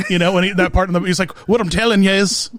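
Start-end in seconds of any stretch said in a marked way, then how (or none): 1.5-2.5 imitating Seamus's accent. Quoting Seamus from Harry Potter, mock Seamus accent. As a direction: put on Seamus's accent for a quote. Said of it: "that one Seamus".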